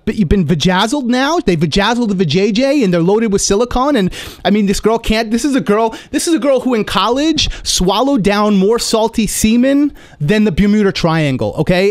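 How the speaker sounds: normal talking voice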